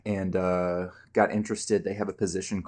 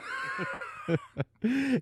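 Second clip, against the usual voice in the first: wheezing laugh with no breath; hoarse raspy laugh; 4 dB quieter than usual. Acted. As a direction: laugh until you can't breathe. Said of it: "I can't breathe laugh".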